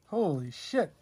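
normal talking voice

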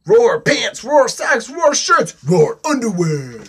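high, loud punchy roars; roaring little dinosaur. Acted. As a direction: shout each item after a roar. Dinosaur roars through the challenge.

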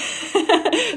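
bright laugh that climbs up; rising laugh. Real, not acted. Laughing at own weather chat.

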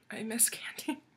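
hushed longing; soft whispered craving; wistful whisper. Real, not acted. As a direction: whisper it like a confession.

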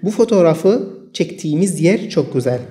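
very slow, words set apart; slow dictation. Shown as a demonstration. Teacher reading the example sentence to students, very slow. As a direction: read it slowly so learners can follow.

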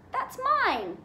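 high, loud cry of protest; indignant protest. A narrator's reading. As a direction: claim it back urgently. Harry protests as letter is snatched.

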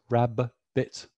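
"rabbit" split slowly into rab-bit, both Bs sounded; over-enunciated. Shown as a demonstration. Teacher showing parents how to over-enunciate "rabbit". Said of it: "we over enunciate".

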